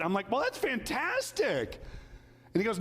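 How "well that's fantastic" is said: much higher, slow, bright-eyed praise; a friend's delighted reply, said higher; mock cheerful congratulation. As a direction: gush a little over the good news.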